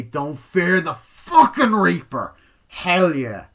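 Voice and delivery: loud, slow and drawn out with glee; excited hype. Real, not acted. Fan hyping the song in the movie, loud slow glee. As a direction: belt the song title like a fan.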